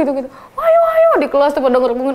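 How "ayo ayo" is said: high, loud cheering chant; crowd egging on. Retold or imitated. Classmates cheering the fight on, high loud chant. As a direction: egg on the fight.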